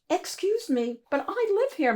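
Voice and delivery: put-on high, small polite child voice; a tiny boy standing up to a giant; little Mo. Little Mo politely confronting the monster, high and small. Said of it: "Mo said".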